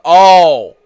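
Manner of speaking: loud, sudden "Oh" of realisation; surprised. Real, not acted. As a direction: catch on all at once. Suddenly realises who is meant.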